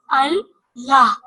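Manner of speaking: Allah with the ल held long and hit hard; Allah drawn out, लाम said twice; doubled lam stressed. Shown as a demonstration. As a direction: lean on the double ल in Allah, said very slowly. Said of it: "लाम को हमने दो दफा पढ़ा".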